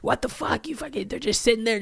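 high, squeaky mock protest; outraged plant lover. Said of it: "plant heads are coming at me".

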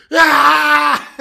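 loud, high startled scream; startled kid scream. Retold or imitated. Child woken too quickly, loud startled scream. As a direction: scream like a kid jolted awake. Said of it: "he's like".

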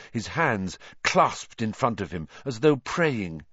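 ordinary delivery

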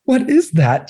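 squeaky disbelief, way up high; amazed high question. Retold or imitated. Friends hearing Yesterday for the first time, amazed high question. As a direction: gasp it out like you can't believe your ears. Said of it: "they're like".

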